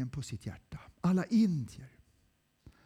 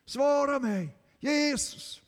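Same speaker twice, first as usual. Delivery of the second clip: shouting desperately to God; anguished shout for help; 10 semitones higher, 5 dB louder, slower than usual. Acted. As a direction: cry out for help at full voice. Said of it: "Jag bara ropar".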